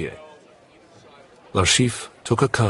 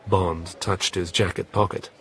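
plain reading voice

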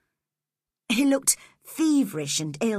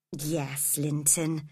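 soft, kind reassurance; gentle; 5 semitones lower, 5 dB quieter, slower than usual. Acted. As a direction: lower and softer, gentle reply. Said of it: "Cathy said gently".

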